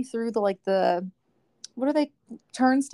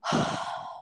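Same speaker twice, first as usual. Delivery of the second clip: imitated frustrated sigh; 6 dB quieter than usual. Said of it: "He goes".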